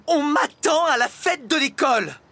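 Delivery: high, loud outraged yell; angry roar. A narrator's reading. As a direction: yell it furiously, pitch way up. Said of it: "rugit la maîtresse en colère". (cri de maîtresse en colère)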